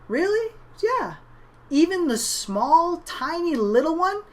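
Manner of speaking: put-on amazed little boy. Little brother asking the clown about the tiny balloon, high and amazed. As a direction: sound like a child who can't believe it.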